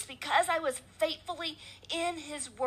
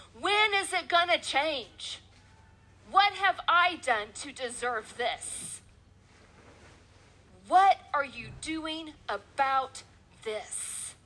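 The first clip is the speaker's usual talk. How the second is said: pleading cry to God; 2 semitones higher, slower than usual. Retold or imitated. On the treadmill, crying out to God in slow anguished pleading. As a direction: ask God why, dragging each word out. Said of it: "I would call out to him".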